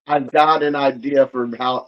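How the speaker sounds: ordinary delivery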